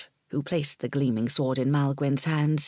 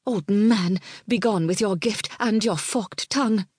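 hissing anger; 5 semitones higher, 3 dB louder than usual. Acted. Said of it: "Nesta hissed like a cat".

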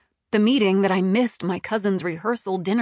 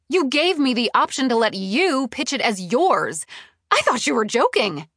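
high, loud, incredulous protest; heated, indignant outburst; incensed; 5 semitones higher, 5 dB louder than usual. A narrator's reading. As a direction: hurl his own words back at him. Furious retort in quarrel.

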